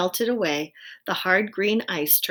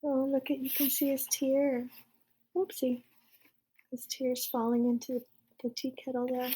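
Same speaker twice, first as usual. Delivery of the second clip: soft aside; 5 semitones higher, 6 dB quieter than usual. Real, not acted. Aside showing picture.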